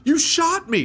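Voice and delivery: high, loud shocked cry; hammed-up high outrage; shocked victim. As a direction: squeal it in disbelief. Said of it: "the guy's like".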